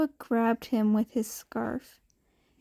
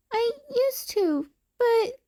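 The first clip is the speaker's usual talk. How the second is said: breaking off sadly; 11 semitones higher than usual. A narrator's reading. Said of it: "he cut off".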